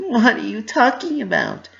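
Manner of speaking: high, loud mock-innocent pony voice; Pinky playing dumb. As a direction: brush it off with fake brightness.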